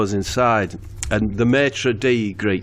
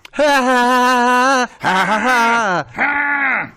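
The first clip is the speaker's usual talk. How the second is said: high hammed-up "ha ha"; fake laugh; 11 semitones higher, 4 dB louder, much slower than usual. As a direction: bark out a forced laugh. Character laughs at his joke.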